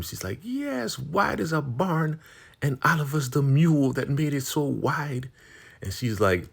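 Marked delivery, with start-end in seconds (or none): 0.4-5.3 high mock-gloating insult; imitating a rival rubbing it in; taunting. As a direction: crow the insult in a high, teasing voice. Gloating insult in acted quarrel.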